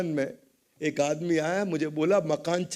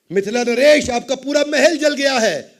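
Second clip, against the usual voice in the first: loud, high announcement; acted urgent shout, voice raised; alarmed messenger; 7 semitones higher, 9 dB louder than usual. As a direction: cry out the bad news to the king. Messenger cries news to king.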